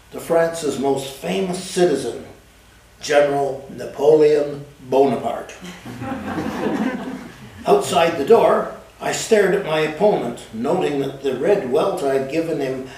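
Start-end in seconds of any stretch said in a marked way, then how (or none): 5.6-7.3 breathy laugh. Real, not acted. Narrator laughs at own story.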